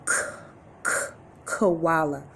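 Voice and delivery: k, k, then koala, tapping the first sound. Shown as a demonstration. Teacher sounding out koala's first letter. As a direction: isolate the k before saying koala. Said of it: "Does that start with I".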